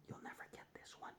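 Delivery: whispered tease, quick; teacher whispering a playful challenge; teasing whisper. Acted. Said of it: "teasing whisper to students".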